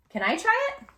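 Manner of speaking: high, bright, eager asking; eager boy. Acted. Andres asking Kino to try the cube, eager and high.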